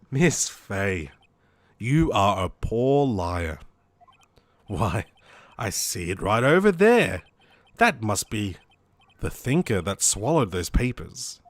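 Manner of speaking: low, drawn-out villain voice; low-pitched, gloating bad guy. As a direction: toy with her as the villain. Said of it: "I wanna do a bad guy voice".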